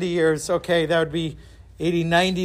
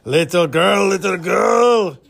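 louder, drawn-out fishmonger character voice; 5 dB louder, slower than usual. Acted. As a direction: put on the old fishmonger, slowly calling out. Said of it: "in the same voice as he used for the fishmonger".

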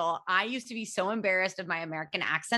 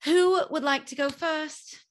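high, slow put-on English accent; hammed-up English accent; 7 semitones higher, 3 dB louder than usual. Shown as a demonstration. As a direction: ask like a mock English hostess. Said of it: "in an English accent".

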